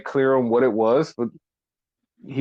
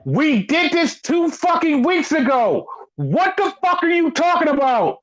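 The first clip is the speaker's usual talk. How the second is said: very high-pitched outraged yell; reliving a screaming outburst, pitched way up; re-enacted yell; 14 semitones higher than usual. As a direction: yell at the TV in exasperation. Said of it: "I yelled at the screen as loud as possible".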